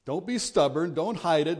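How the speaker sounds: ordinary talk, nothing marked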